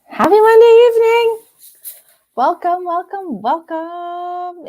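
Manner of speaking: high, drawn-out singsong hello; sing-song greeting. Real, not acted. Host welcomes viewers to Monday evening stream, sing-song greeting. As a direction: call out to everyone, stretching each word high.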